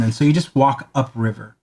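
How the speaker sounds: ordinary delivery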